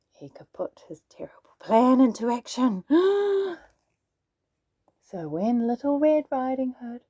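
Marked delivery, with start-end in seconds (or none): 0.1-1.5 secretive narrator whisper. A narrator's reading. Narrator reveals the wolf's plan, whispered. 2.9-3.5 a high startled intake of breath; sharp gasp. A narrator's reading. Narrator gasps at the wolf's plan, sharp gasp. Dramatic pause in the story.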